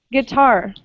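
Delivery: "Guitar" as gui-TAR; second-syllable stress. Shown as a demonstration. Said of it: "the stress on the second syllable".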